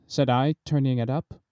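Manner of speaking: ordinary delivery, nothing marked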